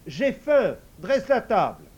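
idiot ordering. Acted. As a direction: order the table like the old man.

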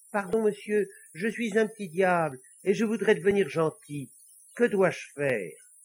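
high-pitched, polite little devil voice. Acted. Little devil asking the priest how to be good, high and polite. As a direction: plead politely as a small devil.

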